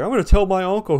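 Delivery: high, loud tattling protest; mock indignant threat. Friend threatening the boss with his uncle, mock indignation. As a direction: imitate a wronged buddy threatening to tell.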